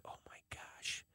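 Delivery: barely audible exasperated whisper; hushed, voiceless aside. Retold or imitated. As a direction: whisper it privately under your breath. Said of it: "I'm whispering to her".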